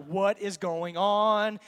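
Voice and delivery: very slow, stretched disbelief; slow, stunned inner exclamation; drawn-out amazement. Retold or imitated. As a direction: stretch every word in mock astonishment. Retelling his amazed inner thought.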